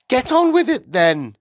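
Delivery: very high, loud order; irritated high-pitched wife; impatient. A narrator's reading. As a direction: hurry the visitor along crossly. Irritated wife hurries teacher.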